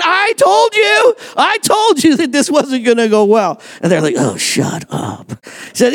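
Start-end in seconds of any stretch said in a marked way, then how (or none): 0.0-3.6 high, drawn-out mock shout; hammed-up I-told-you-so. Paul crowing to the crew that he warned them, hammed-up. 4.2-5.3 low, slow, fed-up groan of words; acted weary retort. Crew answering Paul's told-you-so, wearily fed up.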